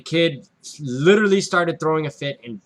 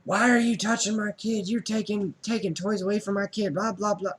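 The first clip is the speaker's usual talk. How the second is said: higher, mock angry mom rant; mom cussing me out; 6 semitones higher than usual. Retail worker imitating an angry mother yelling at her, raised pitch. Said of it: "proceeds to cuss me out".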